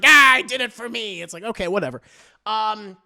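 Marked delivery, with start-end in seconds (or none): 0.0-1.2 mock loud outburst. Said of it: "So he's like".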